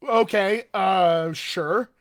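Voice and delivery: mock hesitant yes, starting loud; hesitant agreement. Dancer answers a surprise ballet request, acted hesitant. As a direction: act out an unsure, stalling yes.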